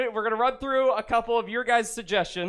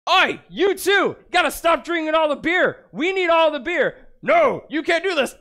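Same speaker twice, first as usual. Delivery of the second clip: shouting dwarf; 6 semitones higher than usual. Acted. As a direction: bellow at the dwarves across the bar. Acting out a dwarf bar fight.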